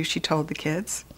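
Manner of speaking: ordinary delivery, nothing marked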